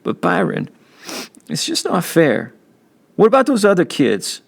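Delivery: put-on a hurt kid begging his big brother to see it; little brother pleading it's not fair; young boy pleading.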